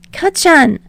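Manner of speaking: rambling. Acted. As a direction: babble on nervously without a breath.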